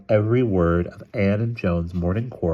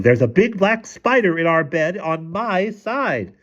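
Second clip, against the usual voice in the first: high, slow, drawn-out yell; 12 semitones higher, slower than usual. A narrator's reading. Mother pounding on the bed about a spider, yelling. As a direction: wail it out in high alarm. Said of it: "pounding on the bed and yelling".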